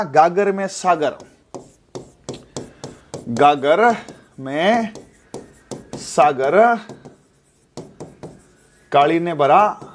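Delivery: drawn out with long pauses between words; slow spaced mnemonic. Shown as a demonstration. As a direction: let each word sink in for the class. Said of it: "कोई story अपन बनाते हैं". (हर शब्द के बीच ठहरकर धीरे-धीरे बोलना)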